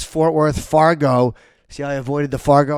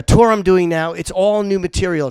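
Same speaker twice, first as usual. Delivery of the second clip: ordinary talk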